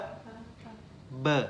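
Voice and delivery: P said unvoiced with a puff of air, B voiced; P and B contrast. Shown as a demonstration. Teacher showing a student P against B, half-whispered. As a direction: show how P differs from B. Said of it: "when I pronounce it".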